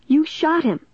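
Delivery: high-pitched, shocked outcry; horrified accusation. A narrator's reading. Narrator's own voice on the tape, high horrified accusation. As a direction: accuse them in a high, stricken voice.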